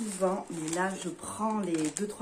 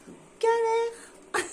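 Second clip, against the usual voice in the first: much higher, slow strained voice; straining effort; 5 dB louder, much slower than usual. Real, not acted. Fighting the tight knots her friend tied, strained effortful groan. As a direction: squeeze it out while pulling at knots.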